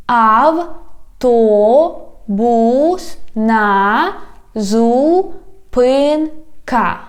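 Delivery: "Автобусна зупинка" very slow, every syllable apart. Shown as a demonstration. Teacher has learners repeat, "Автобусна зупинка" slowly by syllables. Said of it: "divide it into syllables".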